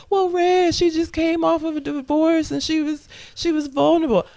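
high-pitched mimicked viewer protest; imagined objector. Host mimics viewers excusing the character, high objector voice. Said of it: "anybody that's seen the movie is gonna say".

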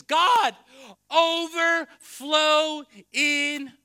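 slow, high, very loud cry to God; shouted prayer. Real, not acted. Pastor crying out for God's overflow, shouted prayer. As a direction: belt it out, stretching each word.

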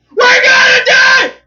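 high, loud, very slow scared wail; mock scream. Imitating the kid on the ride, screaming.

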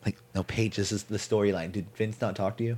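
very soft, low confidential voice; hushed acted aside. Colleague quietly telling Paige it's a storyline, hushed aside. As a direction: quietly take someone aside, under the scene.

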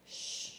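soft shh to calm; hushing. Acted. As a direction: hush it quietly. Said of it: "he looked at it and he said".

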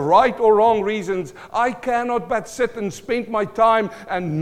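high, loud, driving sermon delivery; full-force preaching. Real, not acted. Pastor tells congregation why he keeps preaching, impassioned preaching. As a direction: preach it hard, voice lifted high.

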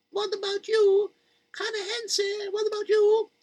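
high, thin worried mother voice; fretting mother. Acted. Mother asking Malkele about the kids, high and fretting. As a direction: fret like a mother scared for her kids. First mother asks again.

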